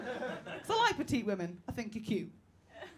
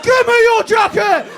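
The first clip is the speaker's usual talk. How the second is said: loud, higher, slow shouted order; bellowing demand; 9 semitones higher, 17 dB louder than usual. Retold or imitated. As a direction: demand the jacket at full volume.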